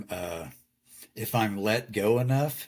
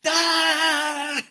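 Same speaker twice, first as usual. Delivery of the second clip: a sudden, much higher, louder mock rage shout "Ah!"; angry outburst yell; 4 dB louder than usual. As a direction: blow up in a sudden frustrated yell. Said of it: "I just go thermal".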